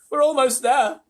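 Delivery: high, loud excited call; shouted. A narrator's reading. Old grasshopper urging the crew, excited shout. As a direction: shout that success is near. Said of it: "shouted the old green grasshopper".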